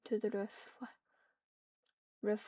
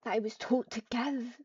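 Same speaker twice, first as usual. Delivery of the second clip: louder, angry and defensive; angry hiss; 5 dB louder than usual. Acted. Cat accused of poisoning her friend, loud angry retort. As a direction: snap it back like an accused cat. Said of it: "She hissed angrily".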